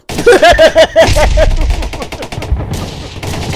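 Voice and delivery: loud, long burst of laughter; laughing. Real, not acted. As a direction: crack up loudly and helplessly mid-story. Cracks up mid-retelling.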